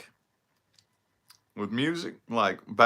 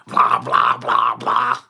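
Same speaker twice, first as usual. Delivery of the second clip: mocking bad guitar blah; 8 dB louder, much slower than usual. Shown as a demonstration. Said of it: "Guitar sounded like shit".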